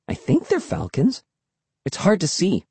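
high, tentative musing; unsure. A narrator's reading. Emily squinting up at far birds, unsure. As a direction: muse with the pitch lifted, unsure.

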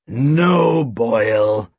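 drawn-out no, dropping low; tired restraint. A narrator's reading. Chief restraining his sidekick, tiredly. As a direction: rein in the thug wearily. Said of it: "Snape shook his head tiredly".

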